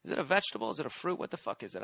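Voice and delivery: ordinary delivery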